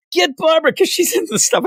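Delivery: very high, mock-urgent call; high-pitched teasing imitation; half making fun. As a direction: tease an absent colleague, half joking. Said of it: "kinda like half making fun".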